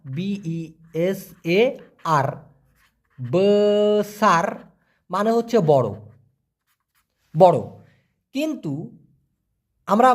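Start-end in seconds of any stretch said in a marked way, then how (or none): 0.0-4.5 letters then বেসার stretched very slowly; slow spelling and saying. Shown as a demonstration. Teacher spelling and slowly pronouncing a Malay word. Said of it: "Malaysian ভাষায় বেসার".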